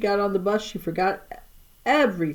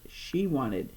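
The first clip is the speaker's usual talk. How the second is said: ordinary delivery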